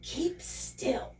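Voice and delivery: hushed, nearly whispered instruction; quiet voice. Acted. Duck speaking in the drowning piglet's ear, quietly. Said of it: "said the quiet voice".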